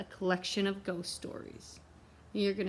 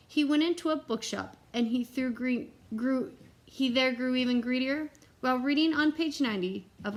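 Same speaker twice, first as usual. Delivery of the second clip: put-on lively read-aloud to children; storybook reading; 4 semitones higher, 3 dB louder than usual. A narrator's reading. Reading a picture book to the children, lively storybook voice. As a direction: read it like bedtime for the kids.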